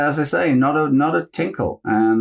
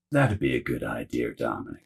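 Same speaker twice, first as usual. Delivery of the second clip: much lower and softer, dry and deadpan; deep Rick Berman; 12 semitones lower, 8 dB quieter than usual. Retold or imitated. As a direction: sink the voice low for the producer's dry reply. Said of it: "And he went".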